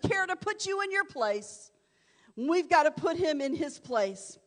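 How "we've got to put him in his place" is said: normal talking voice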